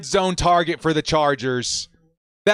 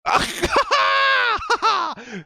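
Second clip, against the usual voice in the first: high shouted laugh; 14 semitones higher than usual. Real, not acted. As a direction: burst of high, whooping laughter. Overjoyed after the draft.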